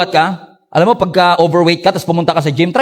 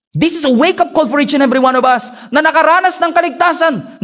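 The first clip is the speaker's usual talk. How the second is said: higher, urgent sermon call; preaching at full force; 10 semitones higher than usual. Acted. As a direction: wake the congregation up. Preaching climax.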